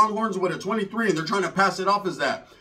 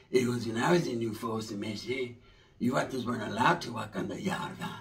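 low, soft put-on old-timer voice; lowered, quiet impression of an older gangster; old vato; 10 semitones lower, 10 dB quieter than usual. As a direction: growl it low like a jaded veteran.

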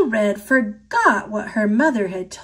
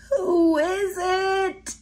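slow, softer croaky disguise; hoarse voice; 8 semitones higher, 4 dB quieter, slower than usual. A narrator's reading. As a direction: rasp like a sick grandma. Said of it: "asked a hoarse voice".

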